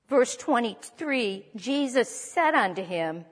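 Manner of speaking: usual speaking voice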